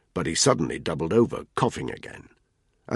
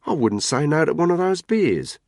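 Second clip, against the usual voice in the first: raised Australian woman's voice; Australian accent; 11 semitones higher, 5 dB louder than usual. Acted. Caitlin asks the men for a beer, Australian accent. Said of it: "Her accent was heavily Australian".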